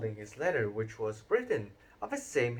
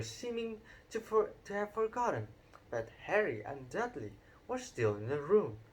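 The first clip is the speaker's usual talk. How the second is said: plain reading voice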